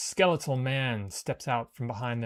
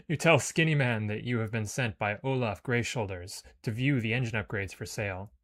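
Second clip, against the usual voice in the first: usual speaking voice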